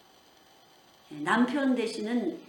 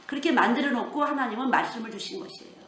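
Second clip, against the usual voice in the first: normal talking voice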